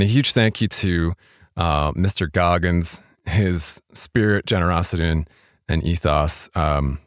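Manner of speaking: ordinary delivery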